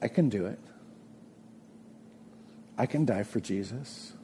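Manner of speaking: calm, humble faith, softly; humble sincere trust. Shown as a demonstration. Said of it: "Or you can say it like this".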